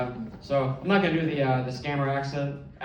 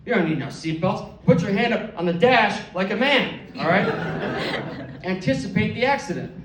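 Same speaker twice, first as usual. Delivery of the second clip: mock macho dad, louder and higher on the dash line; disgusted dad; 5 semitones higher, 3 dB louder than usual. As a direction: scoff it out like a tough old-school father. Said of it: "He looked at me with disgust".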